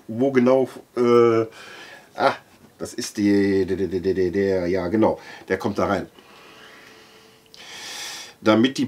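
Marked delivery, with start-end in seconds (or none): none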